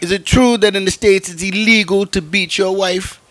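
high, put-on voice of an island stranger; imitated Bahamas local. Bahamas local asking an American about the laws, high imitated voice. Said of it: "He's like".

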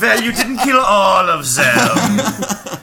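mock German accent. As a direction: ham up a German accent, pitched high. Joking as Nuremberg judge, German accent.

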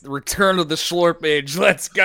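loud, high excited yell; hyped shout. Real, not acted. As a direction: cheer it like a hype man. Hyped for the next fight.

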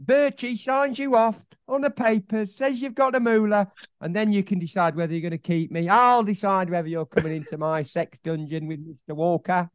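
high put-on old man voice, cheeky; Steptoe-like old man. Co-host answers as Betis, mocking the manager. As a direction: taunt in character like old Steptoe. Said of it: "That went a bit steptoe at the end".

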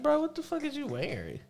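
high, mocking voice mimicking a friend; high mock disbelief at his clothes. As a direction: needle him in a high teasing voice.

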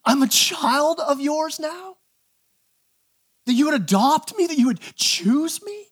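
high, drawn-out questions of acted amazement; blown-away disbelief. Believer asking God about being adopted, acted blown-away disbelief. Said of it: "we would be that blown away".

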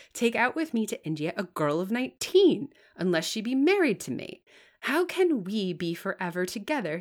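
insistent clergyman. Acted. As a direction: argue as though the point cannot be refused.

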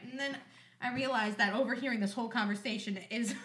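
ordinary talk

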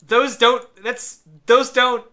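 high, loud, stumbling protest; exasperated sputter. Real, not acted. Critic objecting to mixed-up film references, exasperated.

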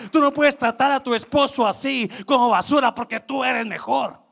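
loud, high, fervent preaching; full-force preaching. Acted. Preacher urging the congregation, full-force loud preaching.

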